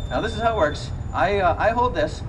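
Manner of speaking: ordinary talk, nothing marked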